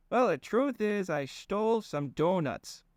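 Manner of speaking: high folksy confession, slowing; sheepish admission in the dopey accent; Fang's goofy accent. Acted. Fang admitting the theft to his cousin, in goofy accent. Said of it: "Ian was doing an accent".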